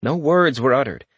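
plain reading voice

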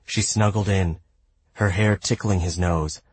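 ordinary delivery

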